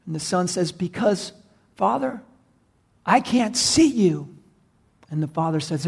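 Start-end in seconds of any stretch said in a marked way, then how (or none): 0.7-4.3 high, drawn-out helpless reply; acted frightened child; scared son. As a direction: answer Dad fearfully from the smoke. Trapped son explains fear.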